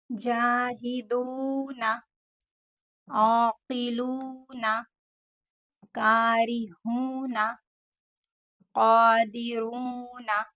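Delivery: each word said very slowly, the ू held two counts; slow tajweed recitation; slow drill. Shown as a demonstration. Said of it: "टू second के लिए extend करके पढ़ा". (हर शब्द में ू को दो सेकंड खींचें)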